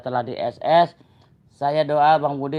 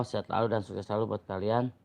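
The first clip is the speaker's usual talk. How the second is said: ordinary talk, nothing marked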